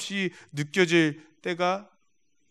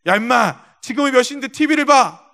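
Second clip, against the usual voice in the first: loud, higher scolding yell; angry father yelling; 4 semitones higher, 6 dB louder than usual. Retold or imitated. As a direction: snap at the son about the late hour. Angry drunk father yells at son.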